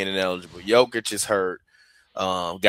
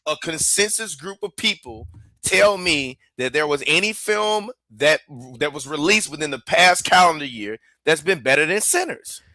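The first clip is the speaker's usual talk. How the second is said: way higher, passionate protest; can't believe anyone would argue this; fired-up defence of a film; worked up; 8 semitones higher than usual. Real, not acted. Arguing Sinners deserves the awards.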